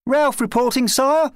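put-on high, loud boy reporting for duty; young lad. A narrator's reading. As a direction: report eagerly to the king. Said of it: "the lad said".

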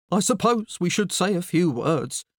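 mildly weary father; a touch of a sigh. A narrator's reading. Father starting a plant's funeral, sighing. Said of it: "Mister McCrumpet sighed".

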